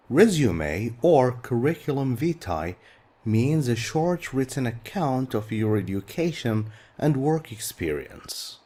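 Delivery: ordinary talk, nothing marked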